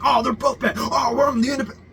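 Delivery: high mock whine of a fence-sitter; mimicking an independent voter in a high nasal whine; mocking whiny voice. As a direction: sneer it out in a put-on whiny tone. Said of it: "You're like".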